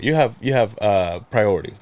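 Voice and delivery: usual speaking voice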